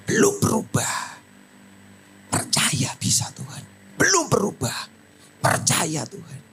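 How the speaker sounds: hushed, whispered urging; preacher whispering encouragement to one struggling believer; intimate whisper. Real, not acted. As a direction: lean in and whisper faith to them. Whispered alternating exhortation.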